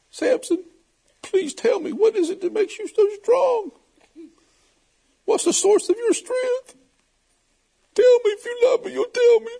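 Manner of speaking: mock pleading in a high voice. Delilah begs Samson for his secret, high mock pleading.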